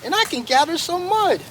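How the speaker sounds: high little animal voice, eager to help; little muskrat. Acted. Muskrat speaks up.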